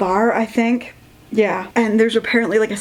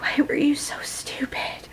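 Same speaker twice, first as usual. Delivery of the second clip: weepy; 6 semitones higher, 7 dB quieter than usual. Retold or imitated. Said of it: "[name]'s getting weepy".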